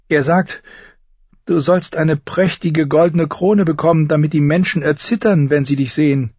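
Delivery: high, measured female voice; voiced young queen. A narrator's reading. Sister translating her husband's words to her brother, high measured voice. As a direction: deliver the message in a high, controlled voice.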